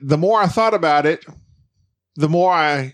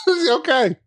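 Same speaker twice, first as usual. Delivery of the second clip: laughing. Real, not acted. Laughing at partner's joke.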